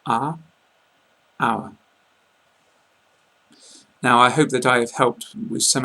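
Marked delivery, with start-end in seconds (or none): 0.0-1.7 are short, our longer and rounder; are versus our. Shown as a demonstration. Teacher asking learners to listen to the pair, clearly. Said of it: "a lot longer".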